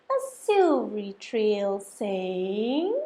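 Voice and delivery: ordinary delivery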